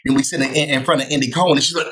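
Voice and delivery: usual speaking voice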